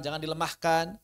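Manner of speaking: normal talking voice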